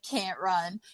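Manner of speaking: ordinary talk, nothing marked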